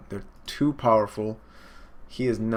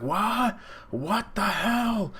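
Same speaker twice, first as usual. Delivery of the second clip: high, drawn-out mock shock; acted disbelief; 11 semitones higher, much slower than usual. Neo reels after the blast, acted high shock. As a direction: stretch it out in high, hammed-up bewilderment.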